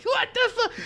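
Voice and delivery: very high falsetto shock; mock freak-out. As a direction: lose your mind in a squeaky voice. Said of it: "I started losing my mind".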